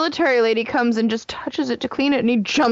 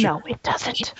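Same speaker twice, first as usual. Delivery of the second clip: playful whispered retort; hushed, voiceless bickering; whispered contradiction; 5 dB quieter, slower than usual. Real, not acted. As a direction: whisper it back as a quick denial. Whispered back-and-forth bickering.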